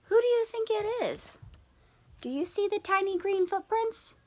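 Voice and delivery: put-on high, hushed teasing question to the kids; playful storyteller hook; teasing mystery voice. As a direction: lean in like sharing a secret. Teacher teases about hidden culprit.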